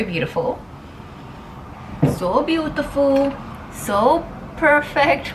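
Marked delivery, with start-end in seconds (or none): 2.2-5.3 high, slow gushing praise; drawn-out swooning quote, pitched up; quoting a video. Retold or imitated. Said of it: "I love that video".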